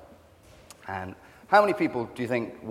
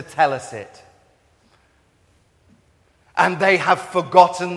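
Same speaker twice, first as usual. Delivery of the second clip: loud, higher, slow impassioned preaching; preaching pastor; 6 semitones higher, 9 dB louder, slower than usual. Retold or imitated. African pastor preaching to forty-five thousand, loud and impassioned. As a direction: thunder it out like a revival pastor. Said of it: "and he said".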